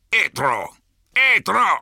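rising angry command shouts; shouting. A narrator's reading. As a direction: bark the word at the rock. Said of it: "schrie er".

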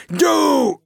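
very high, loud single shriek; furious shriek. A narrator's reading. Corporal screeching at the troll, very high and loud.

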